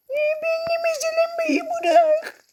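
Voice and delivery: very high, slow cartoon squeak; squeaky Titan voice. Gamer voicing the tiny Titan mob, very high squeak. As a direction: make the tiny Titan sound ridiculous and squeaky.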